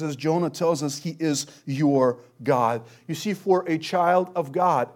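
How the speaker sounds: normal talking voice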